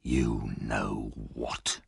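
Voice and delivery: very low, very slow and soft; cold, murderous menace; deadly low. A narrator's reading. Sikes answering Nancy before the attack, deadly low.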